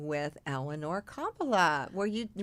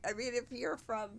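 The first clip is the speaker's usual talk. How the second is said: ordinary delivery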